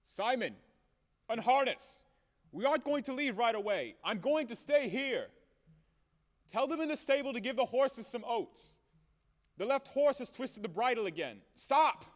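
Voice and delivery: shouting to his coachman Simon outside; shouting orders. Acted. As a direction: call out to the stable hands.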